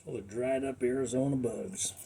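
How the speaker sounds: drawled Southern twang; southern accent. Pretend. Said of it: "Like my southern accent".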